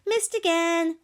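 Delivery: high, loud, gleeful jeer; sneering taunt. A narrator's reading. Fireworm enjoying herself as the monster misses, sneering. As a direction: sing out the miss to mock them. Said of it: "sneered Fireworm".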